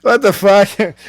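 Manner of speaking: high, loud what-the-hell; loud disbelief. Real, not acted. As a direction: blurt it out, shocked. Shocked outburst.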